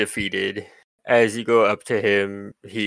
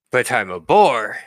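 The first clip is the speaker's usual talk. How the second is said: lightly voiced indignant bird warrior; bird ranger character; 4 dB louder than usual. Bird ranger answers a doubting adventurer, lightly voiced character. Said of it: "he says to the three of you".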